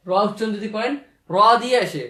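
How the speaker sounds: ordinary talk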